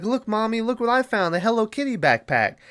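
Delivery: lightly voiced excited child showing off; little girl voice.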